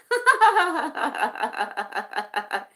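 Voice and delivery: long, high giggling run of laughter; real laughter. Real, not acted. Host amused by a viewer's chili joke, real laughter. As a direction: burst out laughing, letting it tail off.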